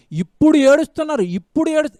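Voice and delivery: emphatic preaching. Acted. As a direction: hammer the phrase home.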